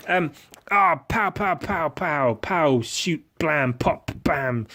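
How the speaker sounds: pow pow, spat out high like toy gunfire; mouth gun noises. Pretend. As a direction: fire off your mouth-gun noises fast. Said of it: "that's my gun noise".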